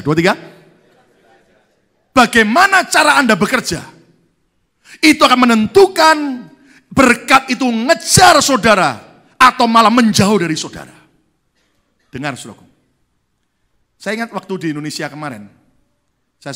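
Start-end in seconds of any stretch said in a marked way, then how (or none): 2.2-11.0 very loud, high preaching cry; full-force preaching. Acted. Pastor driving home his third point to the congregation, at full force. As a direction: preach it to the back row.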